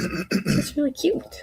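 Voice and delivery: high slow cooing. Real, not acted. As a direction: coo over something adorable. Cooing over her drawing.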